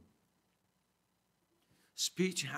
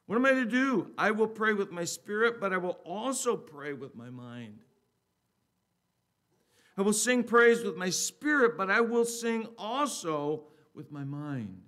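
loud, raised, emphatic Bible reading; scripture reading; 6 semitones higher, 7 dB louder than usual. Acted. Preacher reading scripture to the congregation, raised and emphatic. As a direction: proclaim the verse with deliberate pauses.

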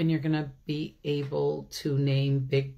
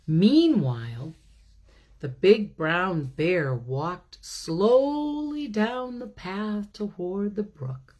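higher, lively read-aloud building to excitement; storybook reading; 7 semitones higher than usual. A narrator's reading. A teacher reads the bear story to children, rising excitement. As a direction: tell it with mounting delight as he smells honey.

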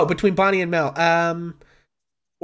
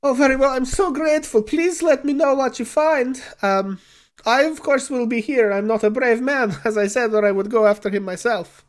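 higher, effusive non-player character voice; grateful, timid client; 9 semitones higher, 3 dB louder than usual. Acted. Client thanks adventurers for searching for his friend, grateful. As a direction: thank them humbly, admitting cowardice.

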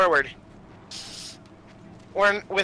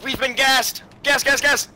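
high-pitched alarm call; excited, high battle call-out; urgent gas warning; 8 semitones higher, slower than usual. Real, not acted. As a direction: raise the alarm to the squad. Warning squad of gas attack.

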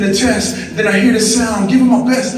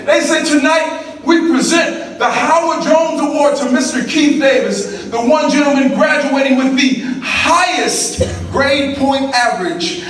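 full-force motivational preaching; 8 semitones higher than usual. Real, not acted. Speaker tells a crowd of his climb to USC, in full-force preaching. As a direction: preach it high and stretched, rousing the crowd.

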